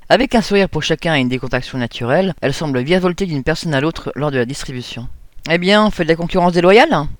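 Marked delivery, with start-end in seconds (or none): 5.5-7.1 sharp, biting jab, a touch louder; taunting the newcomer in front of everyone; cutting remark. Acted. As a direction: crack the line like a lasso. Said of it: "Cette remarque claqua comme un lasso".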